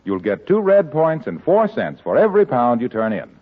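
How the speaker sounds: ordinary talk, nothing marked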